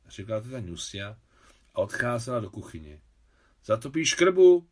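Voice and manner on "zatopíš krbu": loud, high, drawn-out question; raised-voice request in passing; called out. A narrator's reading. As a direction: call it across to the next room. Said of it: "křikla".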